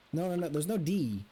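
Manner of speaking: ordinary delivery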